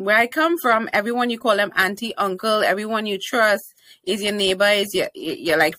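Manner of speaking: ordinary delivery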